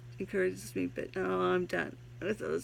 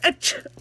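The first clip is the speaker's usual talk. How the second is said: fake sneeze; 10 dB louder than usual. Sneeze gag inside poem.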